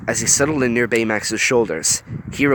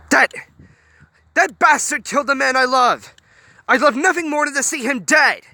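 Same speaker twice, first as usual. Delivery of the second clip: raging; 4 dB louder than usual. A narrator's reading. Honey raging about killer.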